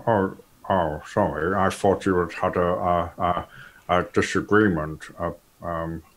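a hint of a prim gentleman introducing himself; posh gentleman voice. Studley introducing himself to Gambetti, mild posh voice. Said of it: "continuing Studley's speech".

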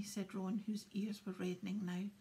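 usual speaking voice

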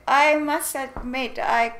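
ordinary talk

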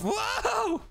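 very high, yelped whoa; startled whoa. Acted. Backing off from the monster, high startled yelp. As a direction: yelp it while backing away.

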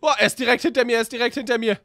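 frantic panicked yelling; being chased. Acted. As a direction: shout in panic while fleeing. Fleeing teacher in game.

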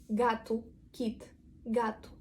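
ordinary delivery

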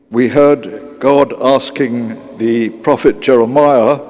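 normal talking voice

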